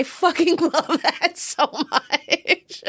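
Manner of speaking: high-pitched, laughing through the words; slow down as the laugh takes over. Real, not acted. Fan gushing about the movie's merch, laughing.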